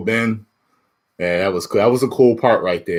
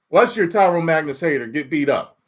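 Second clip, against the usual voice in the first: ordinary talk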